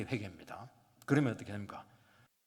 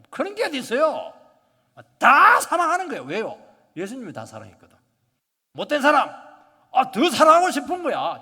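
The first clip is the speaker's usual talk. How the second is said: loud, high, ringing sermon delivery; preaching at full force; 10 semitones higher, 14 dB louder than usual. Acted. Pastor urging the congregation to open their hearts, preaching loudly. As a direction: proclaim it to the whole hall. Preacher exhorting to love all.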